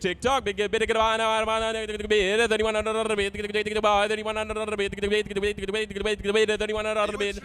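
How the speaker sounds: rapid rolling auction call; auctioneer calling. Acted. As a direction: rattle off the bids fast. Live auction chant.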